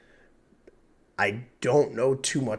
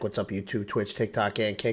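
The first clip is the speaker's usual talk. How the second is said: usual speaking voice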